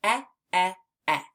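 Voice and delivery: clipped short "eh", repeated; short e sound. Shown as a demonstration. Teacher signs E and models the "eh" sound. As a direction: say "eh" while signing the letter. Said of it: "make the short E sound while we sign".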